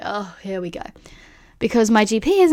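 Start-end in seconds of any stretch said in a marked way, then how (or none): 0.0-0.8 resigned mutter. Retold or imitated. Resigned reply to referral.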